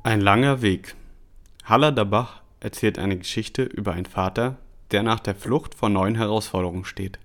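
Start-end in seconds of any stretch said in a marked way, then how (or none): none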